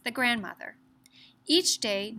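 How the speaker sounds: ordinary delivery, nothing marked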